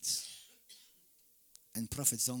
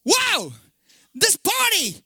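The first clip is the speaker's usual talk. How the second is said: high, loud outburst; preaching shout; 13 dB louder than usual. Real, not acted. Preacher denouncing a political party to the congregation, full shout. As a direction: cry it out to the whole church.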